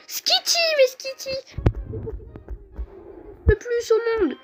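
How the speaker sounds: raging gamer. Real, not acted. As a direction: rant at the useless Pokémon. Outraged at useless Skitty.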